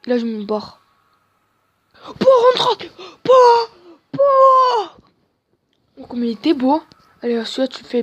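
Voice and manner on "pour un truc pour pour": cri très aigu et fort, voix qui se casse. Real, not acted. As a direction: hurle de frustration. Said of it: "Désolé pour vos oreilles". (hurlement aigu de frustration)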